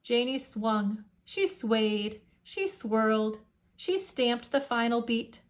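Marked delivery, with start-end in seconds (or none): none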